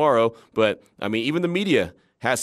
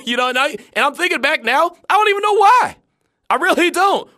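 much higher, laughing at himself; embarrassed laugh; 13 semitones higher, 5 dB louder than usual. Real, not acted. Embarrassed about getting emotional.

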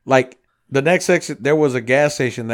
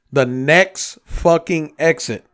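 indignant emphasis; 4 semitones higher, 7 dB louder, slower than usual. Real, not acted. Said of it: "indignant build-up".

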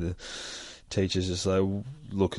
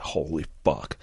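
ordinary talk

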